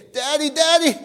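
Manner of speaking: put-on high, begging child voice; child begging parents to talk to them, high and pleading. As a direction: plead like a neglected kid. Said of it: "plead and beg".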